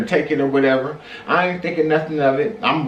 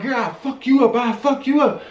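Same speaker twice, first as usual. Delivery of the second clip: mock threat; 7 semitones higher than usual. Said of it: "He getting extra tight".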